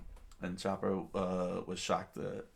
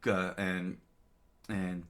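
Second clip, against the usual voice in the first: plain reading voice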